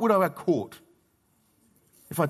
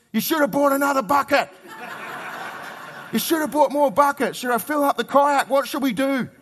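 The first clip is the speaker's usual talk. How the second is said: put-on excited boy; 9 semitones higher than usual. Preacher voicing his son at the beach, eager and laughing. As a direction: blurt it out like a thrilled young son. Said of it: "the only question Isaiah's got is".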